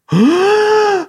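big high-pitched theatrical gasp; exaggerated intake of horror; mock shocked gasp. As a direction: gasp as if scandalised, hammed up. Mimics a scandalised listener.

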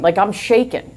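plain reading voice

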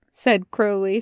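usual speaking voice

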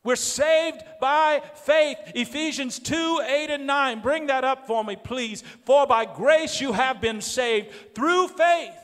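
loud, high, fervent sermon delivery; full-force preaching. Real, not acted. Preacher tells the church we are saved by faith, full-force preaching. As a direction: preach it out, loud and high, stretching key words.

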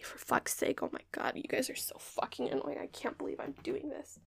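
very soft, almost whispered aside; muttered exasperation. Real, not acted. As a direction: grumble it under your breath. Exasperated aside under her breath.

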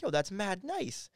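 slow, high, drawn-out appreciation; impressed. Retold or imitated. He is handed free clothes, impressed and drawn out. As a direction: stretch it out in pleased amazement.

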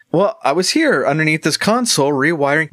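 literal gnome voice. Acted. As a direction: answer innocently as the gnome. Gnome answering.

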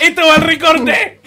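riendo. Real, not acted. Se ríe de lo que se dijo.

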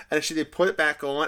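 usual speaking voice

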